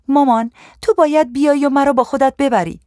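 high-pitched, urgent appeal; distressed pleading. A narrator's reading. Homesick daughter phoning her mother to fetch her, high pleading.

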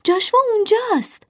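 high-pitched excited call; thrilled, high exclamation; excited cry. A narrator's reading. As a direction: call out the discovery, pitch leaping up. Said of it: "هیجان زده فریاد زدند".

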